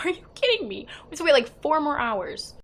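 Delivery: high, loud disbelief; re-enacted exasperated shock; incredulous outburst. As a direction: protest it in mock outrage. Acted shock at a long wait.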